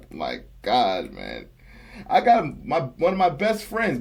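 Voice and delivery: ordinary talk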